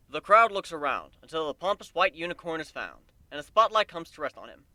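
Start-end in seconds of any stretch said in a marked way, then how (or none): none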